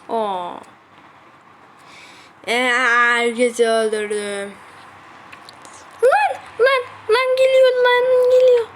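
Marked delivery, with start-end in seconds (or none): 6.0-8.7 high loud alarm that it's coming; panicked yelling. Real, not acted. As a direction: warn in panic. Düşman yaklaşıyor, panik. (panikle bağırarak)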